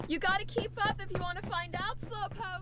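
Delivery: playful teasing. Acted. Rebecca teasing Malcolm to keep up, playful. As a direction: tease him for lagging behind. Teasing friend while hurrying ahead.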